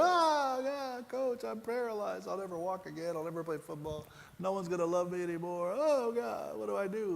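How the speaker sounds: mock despair, begging the coach for help; despairing plea. Injured player pleads to his coach, in mock despair. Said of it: "I started to go".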